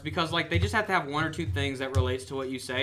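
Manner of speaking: ordinary talk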